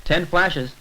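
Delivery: normal talking voice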